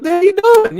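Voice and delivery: high, loud replay of his own yell; sharp yell of disbelief at an interrupting cohost; retold yell. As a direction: shout it like you're cutting someone off on air. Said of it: "Like, I'm yelling at this dude".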